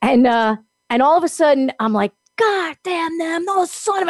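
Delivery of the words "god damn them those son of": cursing. Retold or imitated. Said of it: "I'm cursing all this stuff".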